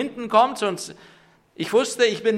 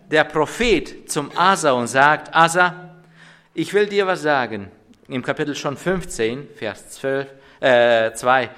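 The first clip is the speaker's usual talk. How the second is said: forceful sermon preaching; 4 semitones lower, 7 dB louder than usual. Acted. Pastor proclaiming the prophet's words to the church, forceful preaching. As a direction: proclaim the verse to the congregation with weight.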